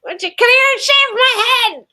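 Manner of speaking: high, loud mock plea; playful shouted demand. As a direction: holler it as a joking invitation. Jokingly calls out an invitation.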